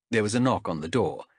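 ordinary delivery, nothing marked

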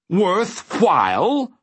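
high, slow, drawn-out outrage; affronted villain, voice leaping up; indignant roar. A narrator's reading. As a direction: throw WORTHWHILE back in rising disbelief. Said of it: "the man roared indignantly".

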